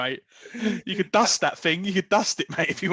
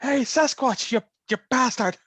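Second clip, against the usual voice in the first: higher, put-on Northern Irish Bigfoot-hunt shout; Northern Irish accent; 5 semitones higher, slower than usual. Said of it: "he's, like, Northern Irish".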